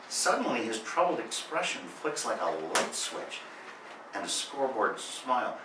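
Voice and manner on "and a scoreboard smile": ordinary talk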